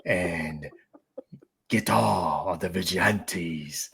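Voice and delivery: low, slow gravelly crime boss; measured menace with pauses; Kingpin voice. Pretend. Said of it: "a pause and then a little aggressive".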